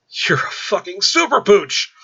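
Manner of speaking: high, loud, slow astonished shout; amazed outburst. Acted. Alan realising Michael's powers, slow loud amazed shout. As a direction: blurt it out in wide-eyed awe. Alan's eyes go wide.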